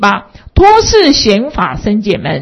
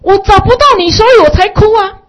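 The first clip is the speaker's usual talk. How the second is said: put-on high, louder child's voice, close to tears; child's reply; 12 semitones higher, 8 dB louder than usual. Boy answering his mother, high and tearful. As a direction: speak up high like a wailing little boy. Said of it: "小孩子回答".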